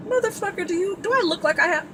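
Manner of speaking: real outrage at a fourteen-hundred-dollar quote; outraged curse. Real, not acted. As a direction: spit it out in disbelief at the price.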